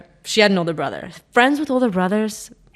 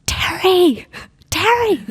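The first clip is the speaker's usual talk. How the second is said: whisper yelling; 11 semitones higher, much slower than usual. Retold or imitated. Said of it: "Like, whisper yelling".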